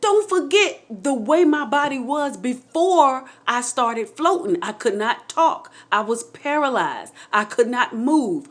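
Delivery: slow, emphatic, building excitement; excited revelation, spelled out slowly; getting excited. Real, not acted. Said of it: "cause I'm getting excited".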